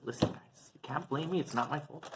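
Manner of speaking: soft, half-whispered excuse; hushed plea. Retold or imitated. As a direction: plead quietly with the judges. Pleading before heavenly court.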